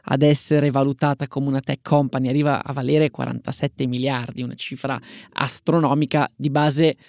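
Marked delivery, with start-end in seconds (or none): none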